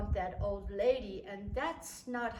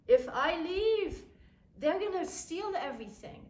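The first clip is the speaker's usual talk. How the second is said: insistent father; 8 semitones higher, 5 dB louder than usual. Retold or imitated. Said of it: "I have to stay here".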